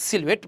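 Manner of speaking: সিলুয়েট with the h silent, ending on a crisp t; correct pronunciation. Shown as a demonstration. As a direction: model সিলুয়েট, gliding through the middle. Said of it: "উচ্চারণটা হচ্ছে কি?".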